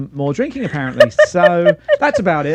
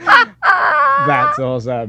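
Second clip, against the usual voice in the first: high, slow, laughing squeal; squealing laughter; 9 semitones higher, 4 dB louder, much slower than usual. Real, not acted. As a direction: coo through laughter at the dog. Squealing over cute dog video.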